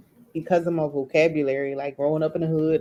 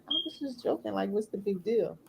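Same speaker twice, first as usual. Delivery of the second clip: inner thought; 2 semitones higher, 10 dB quieter than usual. Retold or imitated. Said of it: "But in my mind, I'm like".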